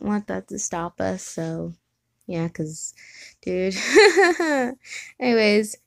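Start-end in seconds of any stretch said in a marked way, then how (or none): 3.8-5.1 a bright burst of laughter; high amused laugh at the interruption. Real, not acted.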